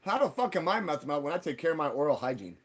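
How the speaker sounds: normal talking voice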